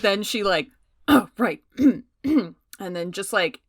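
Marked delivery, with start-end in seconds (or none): 0.9-2.0 throat-clearing recovery. Acted. Player acts recovering from shock.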